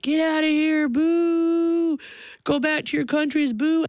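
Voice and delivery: high, drawn-out heckling jeer; imitated booing. Cashier booing mother and daughter out, mock jeering. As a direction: mimic a hostile crowd booing someone out. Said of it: "were booed out of a Walmart".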